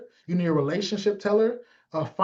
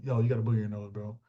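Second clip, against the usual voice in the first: much lower and softer, confiding; discreet aside; 9 semitones lower, 8 dB quieter, faster than usual. Retold or imitated. Quiet confiding tip to a friend about a booger, quiet.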